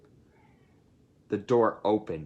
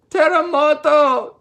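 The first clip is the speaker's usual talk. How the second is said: shrill high cry, much higher and louder; panicked shout; 10 dB louder than usual. Acted. Chihuahua spotting a dinosaur, panicked high shout. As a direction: yell it in sudden panic. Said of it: "shouted Paquito Tito, panic-stricken".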